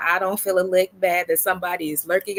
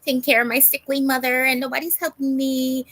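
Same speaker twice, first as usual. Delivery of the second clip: lightly mocking whiny beggar voice, pitched higher; mocking her plea; 7 semitones higher than usual. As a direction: mimic her sob story with a sneer. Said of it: "went on to tell people".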